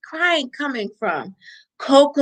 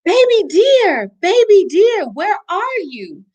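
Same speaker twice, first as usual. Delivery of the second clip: higher, loud searching call; 7 semitones higher, 3 dB louder than usual. Acted. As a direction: call into the dark forest, high and loud. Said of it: "the animals cried as they searched".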